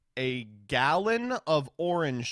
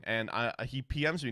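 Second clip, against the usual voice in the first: ordinary talk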